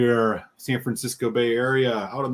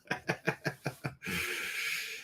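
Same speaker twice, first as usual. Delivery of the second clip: airy unvoiced laugh; breathy laugh; 13 dB quieter than usual. Real, not acted. Host laughing at his own Rams cheer, airy.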